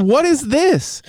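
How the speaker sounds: high shocked question; stunned at the garbage; incredulous. Retold or imitated. Finding candy wrappers in bag.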